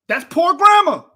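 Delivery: indignant call-out; emphatic. Real, not acted. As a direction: hammer the point home. Angry rant at the video.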